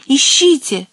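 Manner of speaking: high, urgent command to search; commanding exclamation; urgent order. A narrator's reading.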